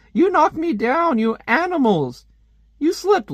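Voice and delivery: high, slow hammed-up complaint; mock outraged woman. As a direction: wail it like a cartoon victim. Woman knocked down in comic.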